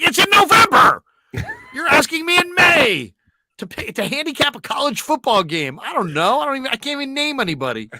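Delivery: high, loud disbelieving outburst; exasperated shout. Retold or imitated. Host yelling back at the caller asking about November. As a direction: yell at him in disbelief, then speed up. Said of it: "I'm like".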